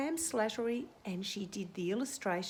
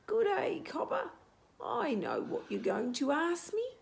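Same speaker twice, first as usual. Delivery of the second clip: much higher squeaky creature voice; 8 semitones higher than usual. Acted. Creature in a rotting log greets Cobber in a much higher squeak. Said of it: "came a squeaky voice".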